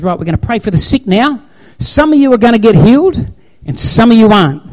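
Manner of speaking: mock crusade preacher proclaiming to the crowd; preacher's loud announcement. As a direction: call it out across a big crusade.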